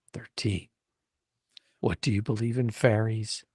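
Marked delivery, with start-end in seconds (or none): none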